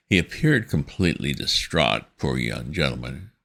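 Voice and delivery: ordinary delivery, nothing marked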